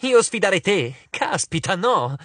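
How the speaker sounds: high, amused disbelief without a laugh; light-hearted denial. A narrator's reading. Annabeth denying any challenge to Arachne, lightly amused. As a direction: brush it off with exaggerated amusement. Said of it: "Annabeth rise".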